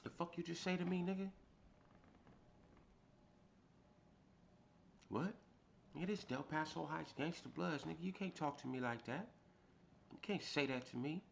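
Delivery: angry confrontation. Retold or imitated. Retold confrontation with the cellmate.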